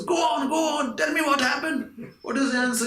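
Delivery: high, eager pleading as the parrot. Acted. Parrot begs the man to tell what happened, eager. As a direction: press him eagerly for the answer. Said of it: "parrot is very eager".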